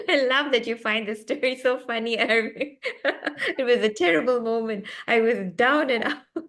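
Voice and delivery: talking through real laughter; amused, laugh breaking into the words. Real, not acted. Author teasing Eric for finding her terrible moment funny, laughing.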